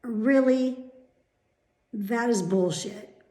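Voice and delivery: loud, exasperated outburst; fed-up rant; real irritation. Real, not acted. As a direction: blurt it out, indignant and loud. Ranting about fake phone voices.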